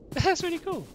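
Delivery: high, loud delighted squeal. Real, not acted. Player thrilled by a pretty find, high happy squeal. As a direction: gush with delight.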